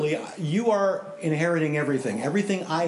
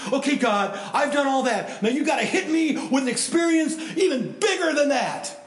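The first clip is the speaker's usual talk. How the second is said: higher, pumped-up dare with a laugh; demanding challenge; 8 semitones higher than usual. Retold or imitated. Stephen daring God to top his thrills, excited. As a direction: egg God on, brash and thrilled.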